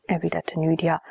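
ordinary delivery

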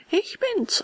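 very high-pitched little reply; puppet voice. Acted. Puppet answers at the door. (hohe Puppenstimme)

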